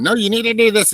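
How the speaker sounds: high, loud mock nagging; mimicking ground crew. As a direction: bark orders up at the bucket. Ground crew yelling up at bucket.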